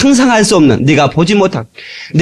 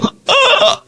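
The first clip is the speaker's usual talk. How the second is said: gasp. Acted. Acting amazed in heaven.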